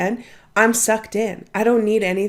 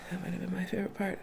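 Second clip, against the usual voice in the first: much softer, almost whispered; soft aside echo; 14 dB quieter, much faster than usual. Real, not acted. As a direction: repeat it to yourself under your breath. Repeats the line twice.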